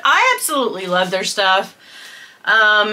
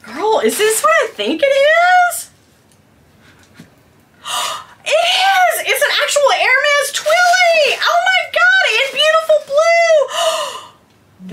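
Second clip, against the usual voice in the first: ecstatic shriek. Real, not acted. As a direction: shriek with real joy, voice cracking. Discovers real Hermes scarf.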